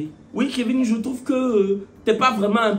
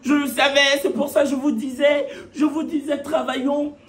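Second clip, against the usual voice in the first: higher, insistent mock boasting; camarade vantarde imitée; 3 semitones higher than usual. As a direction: imitate a classmate claiming all the credit, pitched up. Camarade vantarde imitée après les notes. (imitation d'une camarade qui se vante, plus aiguë)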